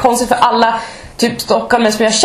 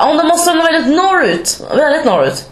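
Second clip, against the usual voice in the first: lightly mimicked coworker, higher-pitched guess; guessing colleague; 7 semitones higher than usual. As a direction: imitate an eager guesser. Imitating the colleague guessing her origin.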